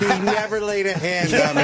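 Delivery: mock loyal wife. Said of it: "you were the best wife".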